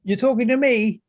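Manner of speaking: high, loud incredulous call; calling back over noise. Acted. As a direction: shout it back across a noisy club. Character yells back over club noise.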